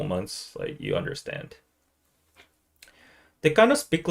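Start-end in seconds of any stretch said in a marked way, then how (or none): 3.4-4.1 tentative, slightly higher attempt at Singlish; badly faked Singaporean English. Shown as a demonstration. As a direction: try a Singlish accent half-heartedly. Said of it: "that's very bad".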